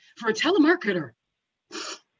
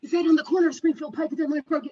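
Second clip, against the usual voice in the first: quick, higher-pitched aside to a colleague; fast acted check, less forceful; hurried relay; 5 semitones higher, faster than usual. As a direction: rattle the question off to someone nearby. Teller relays question to office colleague.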